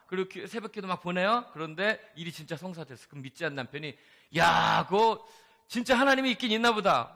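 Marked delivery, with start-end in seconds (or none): 4.4-7.1 amazed husband. Retold or imitated. Unbelieving husband amazed at answer.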